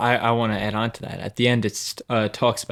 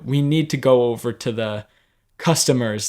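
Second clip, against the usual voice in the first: ordinary talk, nothing marked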